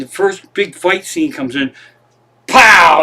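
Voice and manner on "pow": loud, punched "pow" like a comic-book hit; explosive pow. Retold or imitated. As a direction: burst in with the sound of an impact. Acting out fight scene's explosive entrance.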